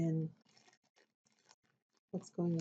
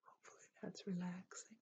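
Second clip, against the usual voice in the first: ASMR whisper; 2 semitones higher, 9 dB quieter than usual. Acted. ASMR host to viewer, dabbing Kleenex, soft whisper. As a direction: whisper gently to lull the viewer.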